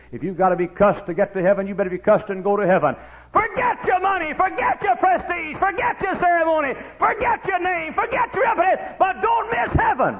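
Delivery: high, loud hollered sermon lines; preaching at full force. Acted. Preacher hollering to the church about Matthew. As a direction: preach it out to the congregation.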